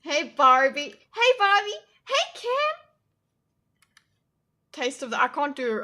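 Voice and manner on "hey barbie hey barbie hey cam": louder, climbing to a very high put-on voice; mock Barbie-world greeting. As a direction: call out like a toy doll, higher each time.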